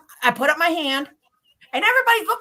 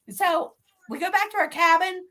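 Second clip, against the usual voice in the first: ordinary talk, nothing marked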